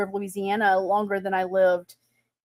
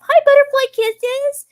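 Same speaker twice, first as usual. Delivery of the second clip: high sweet greeting; 8 dB louder than usual. Acted. Greeting a viewer by name.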